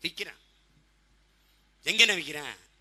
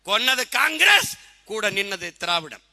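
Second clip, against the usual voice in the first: loud, raised, slow declamation; fiery accusation; 3 semitones higher, 7 dB louder than usual. Real, not acted. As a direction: hurl it at the crowd, slow and loud. (ஆவேசமான குற்றச்சாட்டு)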